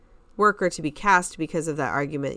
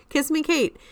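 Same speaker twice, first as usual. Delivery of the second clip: excited exclamation; 11 semitones higher, 4 dB louder than usual. Real, not acted. Excitedly naming the show.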